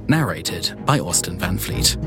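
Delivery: usual speaking voice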